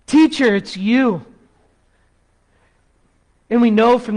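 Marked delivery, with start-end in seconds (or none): 0.0-1.2 higher, louder burst of recognition; acted joyful exclamation; crying out. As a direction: imitate an amazed cry, then ease off. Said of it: "as she cries out".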